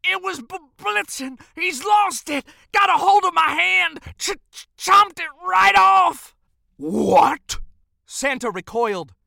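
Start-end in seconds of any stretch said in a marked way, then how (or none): 0.0-6.3 high, frantic shouted stammer; panicked elf crying out at a much higher pitch; shaken elf. Acted. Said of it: "shook as he said". 6.8-7.6 loud, startled disbelief; shocked Santa. Acted. Santa recoils at shocking news.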